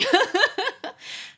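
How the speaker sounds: quick, high giggle; a short, high burst of laughter. Real, not acted. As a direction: laugh at the friend's question. Laughs after the quoted question.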